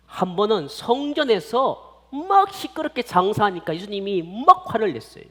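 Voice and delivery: ordinary talk, nothing marked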